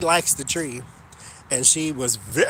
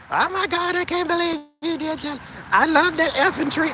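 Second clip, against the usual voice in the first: very high, loud outraged wail; hammed-up shrill outrage at the landscapers; mock screaming neighbor; 13 semitones higher, 4 dB louder than usual. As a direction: shriek it like the furious neighbor over her tree. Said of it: "yelling and screaming".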